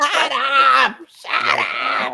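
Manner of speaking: high shout then fading into laughter; laughing mock shout. Laughing while mimicking the congresswoman, mock shout. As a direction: yell it, cracking up.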